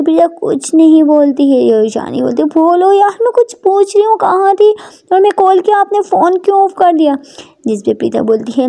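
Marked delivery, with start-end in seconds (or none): 2.5-7.2 high-pitched, insistent interrogation; demanding Ishani. Acted. Ishani asks Prita why the phone was off, demanding. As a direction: question her insistently in a higher voice.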